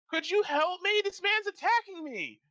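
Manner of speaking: high, loud frightened plea; pleading woman. Acted. Woman pleading with the knight for help. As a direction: beg for rescue in a high girl's voice. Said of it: "she's like".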